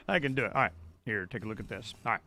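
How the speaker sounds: ordinary talk, nothing marked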